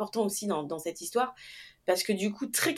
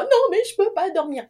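much higher, louder tearful protest; 10 semitones higher, 4 dB louder than usual. Retold or imitated. New mother refusing to sleep, high tearful protest. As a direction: push back through tears, voice way up. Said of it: "je fonds en larmes".

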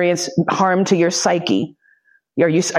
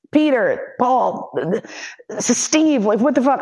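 mock yelling; 6 semitones higher than usual. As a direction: blurt names, then stumble over the words. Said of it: "I'm just yelling out names".

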